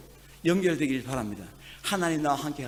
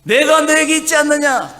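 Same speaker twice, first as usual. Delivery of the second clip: voice of the Lord; 11 semitones higher, 11 dB louder than usual. Acted. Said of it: "주님이 말씀하고 있습니다".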